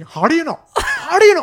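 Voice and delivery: put-on very high, loud, Indian-accented indignation; hammed-up high yelling. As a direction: imitate an outraged big shot, repeating himself. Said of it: "he kept saying".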